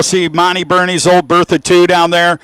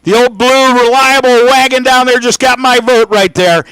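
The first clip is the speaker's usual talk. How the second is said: raised pitch, projected commentary; animated race announcer; 7 semitones higher, 4 dB louder than usual. Real, not acted. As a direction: sell the cars to the crowd.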